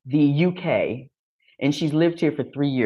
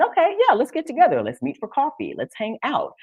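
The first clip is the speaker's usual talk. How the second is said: high, bubbly invitations; eager friendliness; 12 semitones higher than usual. Retold or imitated. New acquaintances making plans, eager friendliness.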